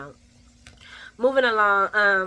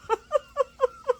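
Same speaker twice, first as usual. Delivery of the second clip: high burst of laughter. Real, not acted. Laughing at own impression.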